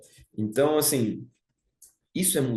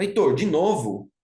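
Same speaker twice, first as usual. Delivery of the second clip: exasperated, louder self-reproach; harsh self-scolding; 3 semitones higher, 5 dB louder than usual. Acted. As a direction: imitate a hard inner critic. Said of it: "É muito duro".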